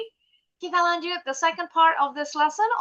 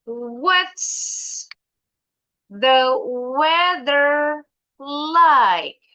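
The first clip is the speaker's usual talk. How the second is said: slow, drawn-out teacher question; demonstrasi guru; 3 dB louder, much slower than usual. Shown as a demonstration. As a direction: stretch each word out for the class. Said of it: "Miss Juliar nanyanya gini". (bertanya pelan, tiap kata dipanjangkan)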